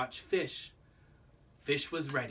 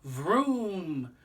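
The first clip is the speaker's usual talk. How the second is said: a revving motor noise; a playful engine rev; engine vroom; 4 semitones higher, 4 dB louder than usual. Acted. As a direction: roar it like a motorbike.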